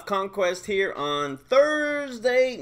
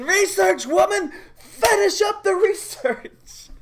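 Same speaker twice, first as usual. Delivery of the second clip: much higher, louder mock scream; screaming at her; 13 semitones higher, 5 dB louder than usual. Nook screams at Peaches to finish research, high mock scream. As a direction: yell it like a frantic teammate. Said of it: "Nook is screaming".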